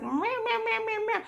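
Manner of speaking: high-pitched mocking whine, nagging nonstop; whiny family member. Nurse mocks demanding family members at the bedside, high whiny mimicry. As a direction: mimic a complainer in a high, bleating voice. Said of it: "some family members that's just like".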